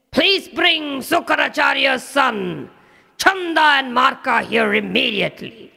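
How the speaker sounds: high, commanding demon-king shout; angry king's order. Acted. Demon king summons his son's teachers, angry shout. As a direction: bark the order at the court. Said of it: "he was so angry".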